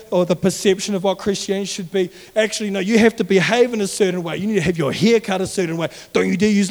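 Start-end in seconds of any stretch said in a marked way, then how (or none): none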